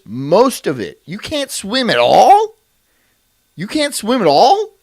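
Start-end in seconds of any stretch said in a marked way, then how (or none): 1.1-2.5 disbelieving question. Acted. Incredulous repeated question. 3.5-4.7 disbelieving question. Acted. Incredulous repeated question.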